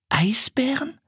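a hushed, unbelieving repeat; whispered echo. A narrator's reading. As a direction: echo the word in a startled whisper. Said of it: "wiederholte Anne".

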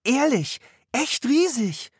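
high, earnest protest; insisting. A narrator's reading. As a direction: make him believe you. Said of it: "beteuerte Anne". (hoch und beteuernd)

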